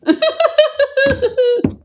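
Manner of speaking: long, high giggling laugh; real laugh. Real, not acted. Streamer after fumbling a name, high laugh. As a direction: giggle high at your own slip.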